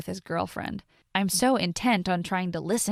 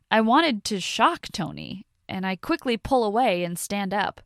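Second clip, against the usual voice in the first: plain reading voice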